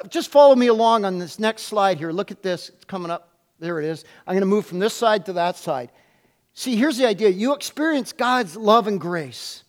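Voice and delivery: ordinary talk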